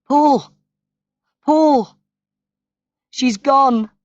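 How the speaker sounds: high, loud desperate cry across the garden. A narrator's reading. Sister running to Paul, crying out loud that mother died. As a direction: call out in high, loud panic.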